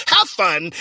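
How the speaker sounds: normal talking voice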